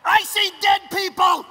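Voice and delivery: high, very loud, slow yell; comic full-volume outcry; hammed-up shout. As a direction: blurt it out like a shocking announcement. Revived Lazarus's outburst in skit.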